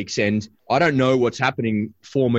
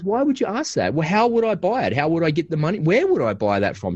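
puzzled daughter; 7 semitones higher than usual. Retold or imitated. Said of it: "And she goes".

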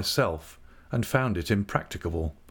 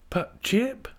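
put-on high, pitying young wife; compassionate; 14 semitones higher than usual. A narrator's reading. Dora looking at her old dog, compassionate. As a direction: pity the dog in a much higher voice. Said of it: "looking at him with compassion".

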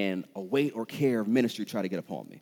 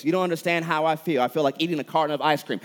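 higher, louder mock whine; lightly acted self-pitying gripe; mock complaining; 5 semitones higher, 5 dB louder, faster than usual. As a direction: protest like a hammed-up complainer. Acts complainer who feels misunderstood.

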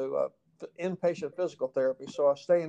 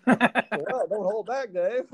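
mock offended; 7 semitones higher, 3 dB louder, much slower than usual. Mock hurt reply to therapist.

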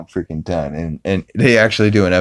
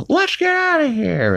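high, hurried Peter voice; high cartoon panic; panicked Peter. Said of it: "Peter's like".

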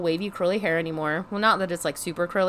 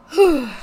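heavy sigh; 5 semitones higher, 6 dB louder than usual. Real, not acted. Resigned about haircut.

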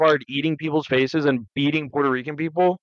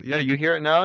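ordinary talk, nothing marked

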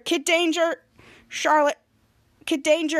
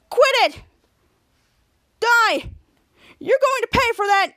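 high, loud furious fight yells; angry shouting; 7 semitones higher, 4 dB louder than usual. Retold or imitated. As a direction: yell it mid-fight. Fighting off the bad guy.

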